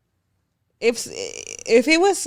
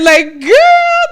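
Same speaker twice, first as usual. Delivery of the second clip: exasperated exclaim; 11 semitones higher, 9 dB louder than usual. Real, not acted. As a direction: protest loudly in disbelief. Exclaiming at partner, laughing.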